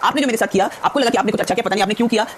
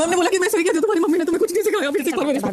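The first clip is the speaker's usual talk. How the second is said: upset, near tears; 7 semitones higher than usual. Real, not acted. Upset after fight, being consoled.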